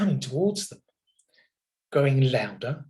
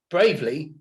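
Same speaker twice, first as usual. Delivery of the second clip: ordinary delivery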